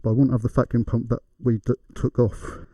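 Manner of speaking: usual speaking voice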